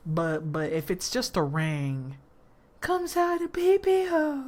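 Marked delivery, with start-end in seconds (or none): 2.8-4.4 put-on high childish pee-pee; silly baby talk. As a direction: say it like a toddler. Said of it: "Don't call it that".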